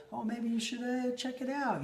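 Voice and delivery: sly sing-song tease; coy hint. Retold or imitated. Husband slyly hinting his wife check the closet, coy.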